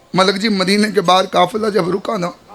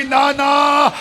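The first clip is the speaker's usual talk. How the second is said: wailing cry; 5 semitones higher, slower than usual. Acted. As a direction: cry out to the grave with all grief. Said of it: "बीबी की आवाज़ आई".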